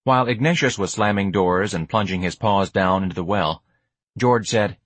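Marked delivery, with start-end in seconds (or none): none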